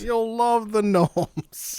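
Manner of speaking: slow, high, showy ad voice; drawn-out announcer voice. Acted. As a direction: sell it like a cheesy promo.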